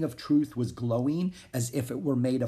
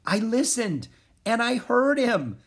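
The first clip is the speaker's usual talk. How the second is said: high, amazed announcement; full of wonder; 12 semitones higher, 5 dB louder than usual. A narrator's reading. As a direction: marvel aloud, pitch soaring, louder. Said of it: "in a voice filled with wonder".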